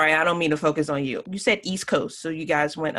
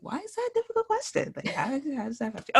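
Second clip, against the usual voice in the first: puzzled inner question; 9 semitones higher, faster than usual. Retold or imitated. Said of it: "I was like".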